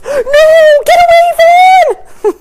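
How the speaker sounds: put-on high, drawn-out toddler yell; loud, shrill mock protest; imitating toddler outburst. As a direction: holler it like a small boy defending his toy. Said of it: "stands up and goes".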